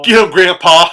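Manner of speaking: loud, high cheer urging grandpa on; cheering shout. Retold or imitated. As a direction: yell it like a fan at a fight. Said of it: "and she goes".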